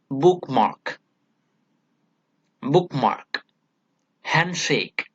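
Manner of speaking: "bookmark" and "handshake" with weight on both halves; stress on both syllables. Shown as a demonstration. Teacher gives spondee examples, both syllables stressed. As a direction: give each half of "bookmark" and "handshake" its own stress. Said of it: "we stress on both the syllables".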